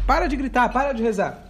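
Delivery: loud, high urging command; God ordering Moshe to act; commanding shout. Retold or imitated. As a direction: push him forward with a big shout. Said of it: "Deus deu uma resposta".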